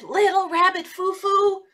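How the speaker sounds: high, loud scolding fairy voice; stern fairy. Acted. Good fairy scolding Little Rabbit Foo Foo, in a high stern voice. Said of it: "and she said".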